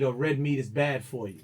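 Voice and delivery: ordinary delivery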